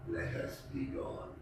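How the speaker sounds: mocking whisper; cold whisper. A narrator's reading. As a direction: breathe his words back at him. Montresor mocks Fortunato's words.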